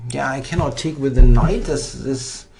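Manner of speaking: ordinary delivery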